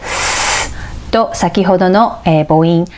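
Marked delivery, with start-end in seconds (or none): 0.0-0.7 "ス" as a vowelless hiss; voiceless, held "ス"; S breath sound. Shown as a demonstration. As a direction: push air out on "ス". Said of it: "子音Sの音".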